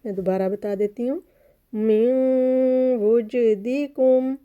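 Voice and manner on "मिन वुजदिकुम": मिन वुजदिकुम with the नून nasalised and the जीम bounced; slow tajweed recitation; nasal sound and qalqala. Shown as a demonstration. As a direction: chant slowly, humming into वु and popping the जीम. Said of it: "वाव पे nasal sound बनेगी".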